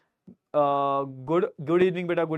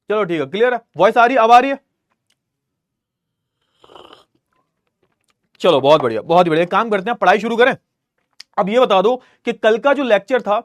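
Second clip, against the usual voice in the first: raised teacher voice, louder and higher; projecting loudly; 4 semitones higher, 9 dB louder than usual. Real, not acted. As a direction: speak up so the online class hears. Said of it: "slow है".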